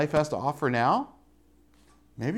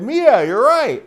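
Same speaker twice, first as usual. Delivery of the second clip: high, loud, drawn-out admission; mock-alarmed yell conceding to Mia; retold calling out; 12 semitones higher, 11 dB louder, slower than usual. Calls to Mia after thunder.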